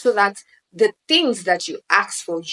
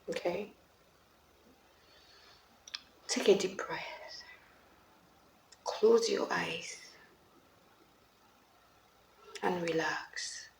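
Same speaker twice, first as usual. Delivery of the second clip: slow guided relaxation voice. Acted. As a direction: ease them into calm. Guided relaxation, feet, breath, jaw.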